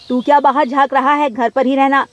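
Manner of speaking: loud, scolding mother; stern scolding. Acted. Mother orders son indoors. (ऊँची आवाज़ में माँ की सख़्त डाँट)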